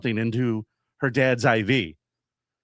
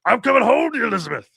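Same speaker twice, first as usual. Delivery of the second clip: high, loud wail that drops away; hammed-up heart-attack farewell; mock dying cry; 8 semitones higher, 5 dB louder, faster than usual. Host hams up a patient's heart attack, mock dying cry.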